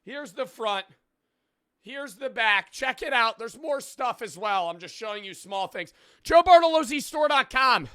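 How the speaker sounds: high, loud, pumped-up sales pitch; over-the-top promo read; hype merch ad. Acted. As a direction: sell the merch like a yelling hype man. Hypes his merch drop.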